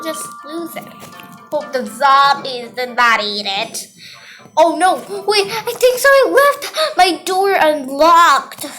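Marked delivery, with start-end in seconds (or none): 4.6-8.6 high, loud rising panic; alarmed. Pretend. Player realises the door was left unlocked, high alarmed cry. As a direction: cry out in play-acted dismay.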